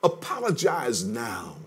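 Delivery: the Spirit nudging toward apology softly; soft urging. Acted. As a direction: quietly press them to make peace. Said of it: "the Holy Spirit is saying".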